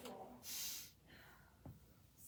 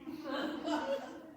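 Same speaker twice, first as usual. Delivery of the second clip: laughing; 3 semitones higher, 5 dB louder than usual. Real, not acted. Laugh after line.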